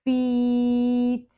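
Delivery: drawn out slowly. Shown as a demonstration. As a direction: stretch the word Feet out long. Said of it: "say some words slow and then fast".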